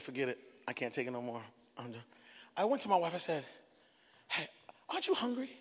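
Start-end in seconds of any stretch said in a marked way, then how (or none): 0.0-2.0 very low, hushed giving up; acted resignation. Husband quitting the fast, hushed resignation. 4.3-5.5 very soft, tentative probe; furtive coaxing. Retold or imitated. Husband tempting fasting wife, soft coaxing.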